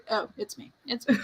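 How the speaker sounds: usual speaking voice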